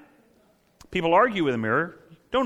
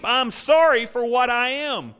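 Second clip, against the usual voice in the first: higher, louder, slow declaration of sorrow; loud confession; 9 semitones higher, 4 dB louder, slower than usual. Shown as a demonstration. Preacher voicing the sinner's confession, loud and slow. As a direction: proclaim the confession like a sermon climax. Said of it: "mourn, and weep".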